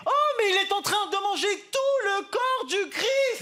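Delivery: very high, slow horrified shriek; outraged nun. Retold or imitated. Nun seeing the boy eat communion, in a very high slow shriek. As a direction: squeal in holy outrage. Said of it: "Elle a fait".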